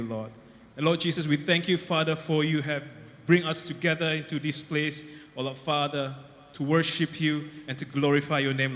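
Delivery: earnest, loud church prayer; fervent prayer. Acted. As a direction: pray with rising fervour. Leads an opening prayer.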